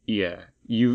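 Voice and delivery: normal talking voice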